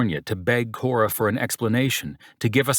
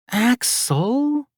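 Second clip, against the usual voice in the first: high whining woman's voice, name drawn out; whined; 4 dB louder than usual. A narrator's reading. Girlfriend whining his name from the bed. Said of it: "she whined".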